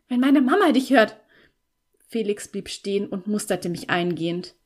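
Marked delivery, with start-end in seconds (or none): 0.1-1.2 higher, louder, urgent warning; alarmed warning. A narrator's reading. Girl warning Felix that Mama might hear, alarmed. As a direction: warn urgently, close to panic.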